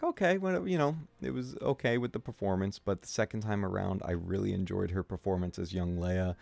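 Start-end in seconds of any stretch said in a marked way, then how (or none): 0.0-2.8 high, half-hearted shrug of a verdict; grudging first reaction. Retold or imitated. Streamer recalls first viewing, mock lukewarm shrug. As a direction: lightly play your lukewarm first self. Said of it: "I was like".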